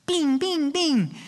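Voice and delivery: a weak little bottle rocket ping; wimpy rocket pings. Retold or imitated. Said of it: "That's how it sounds".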